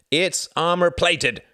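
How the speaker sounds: high, loud, slow snarl. A narrator's reading. Pilot rebutting his comrade's doubts, snarling slowly. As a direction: stretch the words out in angry emphasis. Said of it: "snarled Jorgen".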